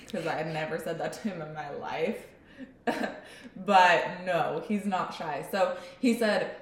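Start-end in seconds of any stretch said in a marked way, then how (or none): none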